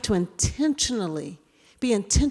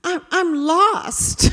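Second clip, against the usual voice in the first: higher, slow hesitant stammer; higher flustered halting lie; flustered excuse; 9 semitones higher, 5 dB louder, slower than usual. Retold or imitated. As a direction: fake being lost, stalling. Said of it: "And I said".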